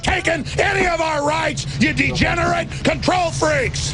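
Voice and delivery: furious shouted rant. Real, not acted. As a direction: rail at them, pitch climbing. Ranting against control freaks.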